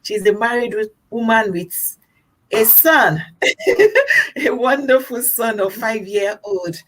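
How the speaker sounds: normal talking voice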